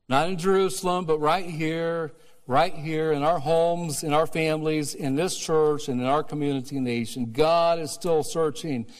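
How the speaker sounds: preaching at full force. Acted. Pastor declaring God is still searching, loud preaching. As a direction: build it over the congregation.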